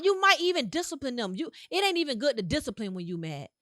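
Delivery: ordinary delivery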